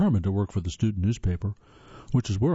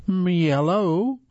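slow, high, louder Southern grandma answering the phone; throaty grandmother greeting; 9 semitones higher, 4 dB louder, much slower than usual. Acted. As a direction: draw out a grandmother's phone hello. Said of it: "she answered with a throaty".

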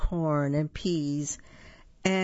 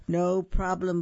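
ordinary delivery, nothing marked

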